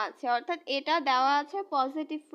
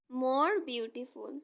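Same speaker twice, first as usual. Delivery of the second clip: very slow, deliberate more beautiful; slow model phrase; much slower than usual. Shown as a demonstration. Teacher modelling the comparative for students, very slowly. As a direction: spell out more beautiful word by word. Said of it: "more beautiful হবে".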